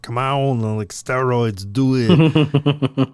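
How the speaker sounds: hammed-up action-hero voice, building to a loud finish; Arnold Schwarzenegger. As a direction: bark it like a musclebound action hero. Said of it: "Arnold Schwarzenegger times ten".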